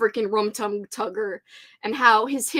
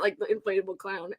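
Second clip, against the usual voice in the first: ordinary talk, nothing marked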